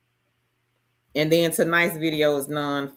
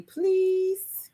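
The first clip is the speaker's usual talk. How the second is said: cute high plea; 13 semitones higher than usual. Real, not acted. Asking viewers to share video.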